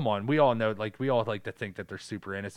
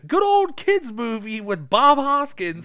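higher, louder, slow cheery parent voice; mock parents; 13 semitones higher, 9 dB louder, slower than usual. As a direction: hand it over like a careless dad. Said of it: "And they were like".

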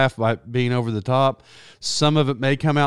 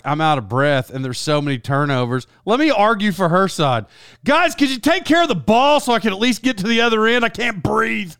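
put-on out of breath girl; 6 semitones higher than usual. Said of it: "That's what I'd have said if I was her".

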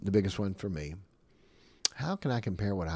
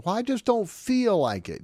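whiny excuse; 11 semitones higher, 5 dB louder than usual. Retold or imitated. Voicing a reluctant listener.

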